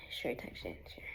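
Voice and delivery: whispered offhand reply; whispering. Retold or imitated. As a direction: whisper it, shrugging. Mocking his own reply to texts.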